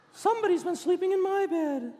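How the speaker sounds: very high Mama Bear voice; hammed-up storybook bear mother complaint; Mama Bear growl. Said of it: "the Mama Bear growled".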